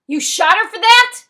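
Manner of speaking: high, very loud incredulous question; shocked yell. A narrator's reading. Voice on the phone yells at the boy, shocked high shout. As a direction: shout it in disbelief. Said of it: "the voice yells".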